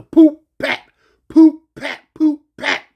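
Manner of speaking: loud popping slap noises; imitated punching sounds. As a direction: smack out each blow. Imitated blows.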